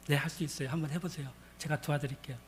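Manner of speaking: low, soft reassuring answer; gently encourage, voice dropped; quiet, confident reassurance; calm reassurance. Retold or imitated. Pastor reassures the astonished woman.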